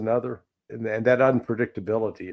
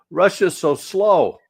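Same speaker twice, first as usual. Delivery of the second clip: slow, louder mocking quote; mocking whine; 8 semitones higher, 6 dB louder, slower than usual. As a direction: drag it out, sneering at Westerners' complaints. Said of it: "complaining about".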